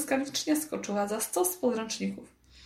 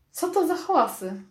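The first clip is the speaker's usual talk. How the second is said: raised, higher demanding call; 6 semitones higher than usual. A narrator's reading. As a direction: burst in demanding an answer. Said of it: "zawołał pan Plinston".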